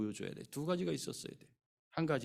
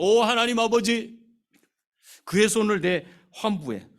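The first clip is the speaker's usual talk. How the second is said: high, loud cry to heaven; fervent shouted invocation; loud prayerful cry; 9 semitones higher, 13 dB louder than usual. Retold or imitated. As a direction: call out to God, big and slow. Imagined prophet calling on God.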